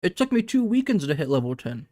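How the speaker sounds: talking with a mouthful of chips; words around a bite of Doritos; chewing while talking. Real, not acted. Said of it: "I'm sorry, I'm chewing into the mic".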